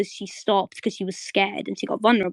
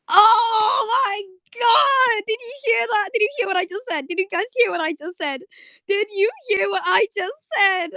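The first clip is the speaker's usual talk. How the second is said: real high-pitched screaming disbelief; excited fangirl shriek; 11 semitones higher, 3 dB louder than usual. Real, not acted. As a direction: shriek it out, completely overwhelmed. Fan overwhelmed by romantic moment.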